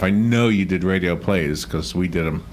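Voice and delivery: normal talking voice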